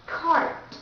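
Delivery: cart with the R sounded, American; American cart, strong R; American accent. Shown as a demonstration. Teacher showing that R is never silent, American accent. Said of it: "the R sound is never silent".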